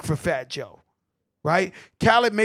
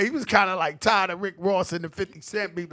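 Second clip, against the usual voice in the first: normal talking voice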